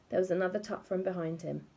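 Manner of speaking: usual speaking voice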